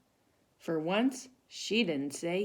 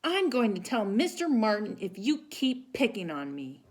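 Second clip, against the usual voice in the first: blurted; 6 semitones higher, 5 dB louder than usual. Acted. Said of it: "She turned around and blurted".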